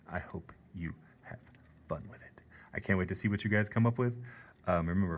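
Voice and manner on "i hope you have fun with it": whisper. Real, not acted. Teacher encouraging students, whispering.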